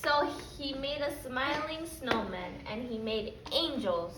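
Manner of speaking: put-on lively storybook reading for little kids; reading to children. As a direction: read it like story time for a class. Teacher reads picture book aloud.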